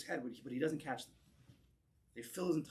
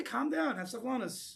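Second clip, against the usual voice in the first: mock soothing; 8 semitones higher than usual. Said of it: "You'd say".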